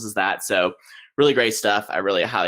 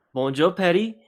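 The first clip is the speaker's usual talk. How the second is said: halting English-accented French; 9 semitones higher than usual. Real, not acted. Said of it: "That is the extent of my French".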